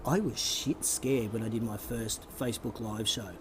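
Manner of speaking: low, hushed confession; leaning in to whisper. Real, not acted. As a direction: lean in and confide it softly. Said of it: "I'll come in close".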